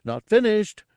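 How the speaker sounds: high, loud character interjection; high-pitched simpleton insisting; insistent declaration. A narrator's reading. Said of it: "Gartog declared".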